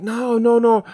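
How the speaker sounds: put-on high Jamaican-accented protest. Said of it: "do my best Jamaican accent".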